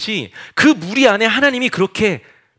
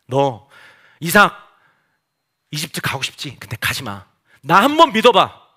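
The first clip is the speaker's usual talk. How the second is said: God reassuring Isaac; 5 semitones lower than usual. Acted. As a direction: gently coax and reassure, firmer on the plea. God's promise to Isaac, acted.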